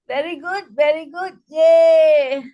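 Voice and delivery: chanted praise ending in a loud yay; celebratory "very good, very good, yay"; cheer. Shown as a demonstration. Laughter club cheer demonstrated.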